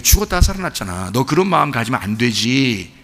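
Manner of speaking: low, soft fatherly rebuke; a hint of a patient father; gentle father. Father reminds elder son brother came back, softly.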